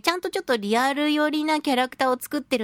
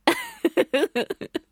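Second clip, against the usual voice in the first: bright burst of laughter, pitch way up; real laugh, falling away; high laugh; 9 semitones higher than usual. Real, not acted. Laughs after describing eating squirrels.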